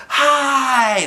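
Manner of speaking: high, loud crowd greeting; audience reply. A narrator's reading. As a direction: answer like a whole hall at once. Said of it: "the whole audience replied".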